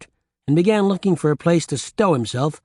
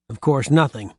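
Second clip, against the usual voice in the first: ordinary delivery, nothing marked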